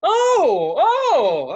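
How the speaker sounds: repeated high, loud "Oh"; overdone loud reaction. Retold or imitated. Bar customer rubbing in his surprise, overdone and loud. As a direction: whoop each one, shamelessly overdone. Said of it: "really doing it bad".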